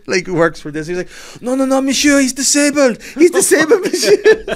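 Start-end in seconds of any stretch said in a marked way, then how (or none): 1.4-4.6 put-on very high, loud French-accented protest; hammed-up French accent, pitched right up; frantic French woman. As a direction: shriek it as a panicked Frenchwoman. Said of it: "This French woman".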